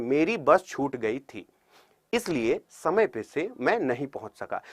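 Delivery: slow, soft reading with a pause at the comma; slow phrased reading. Shown as a demonstration. Teacher showing the right measured way to read a sentence. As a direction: read it unhurried, pausing at punctuation. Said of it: "हर एक चीज का मतलब होता है".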